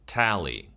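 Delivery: "Tally" with a firmly hit "a"; demonstrated "Tally", stressed open a; strong a vowel. Shown as a demonstration. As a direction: punch the first vowel of "Tally", then fall. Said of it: "항상 좀 세게 잡아주세요".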